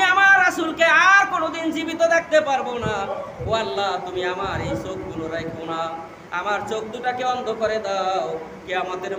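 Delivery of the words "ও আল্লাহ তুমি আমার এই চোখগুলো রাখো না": ordinary talk, nothing marked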